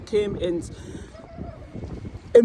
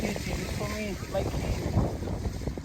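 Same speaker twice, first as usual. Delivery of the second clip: whispered; 9 dB quieter, slower than usual. Real, not acted. Tender address to husband.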